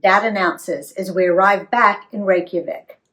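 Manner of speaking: ordinary delivery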